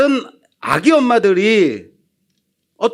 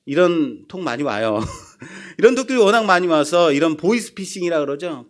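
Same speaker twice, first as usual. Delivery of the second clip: normal talking voice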